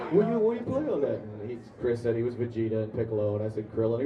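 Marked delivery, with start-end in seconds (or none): 0.1-1.2 high, fast, loud imitated question; eager fan firing off a question; excited accented stranger. Subway stranger asking actors their roles, excited accented voice. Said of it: "people with that strong of an accent".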